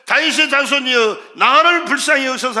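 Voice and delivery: loud, insistent cry for mercy; shouting harder. Retold or imitated. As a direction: imitate a beggar shouting over those hushing him. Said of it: "심히 소리 질러 가로되".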